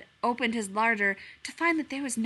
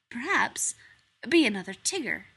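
ordinary delivery, nothing marked

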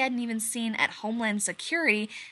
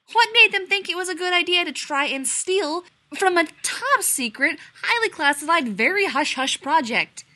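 much higher and louder, slowing at the end; indignant rant; 9 semitones higher, 8 dB louder than usual. A narrator's reading. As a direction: rant in outrage. McKay's indignant rant continues.

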